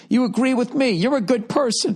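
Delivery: high, mock-sweet gushing voice. Host praising a listener who agrees, high mock-sweet gush.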